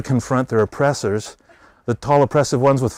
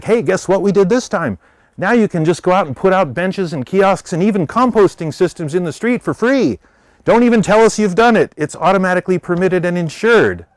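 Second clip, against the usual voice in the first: higher and louder, bursting with good news; gleeful official; 7 semitones higher, 5 dB louder than usual. Retold or imitated. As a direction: brag about the new free permits. Transportation department announcing good news.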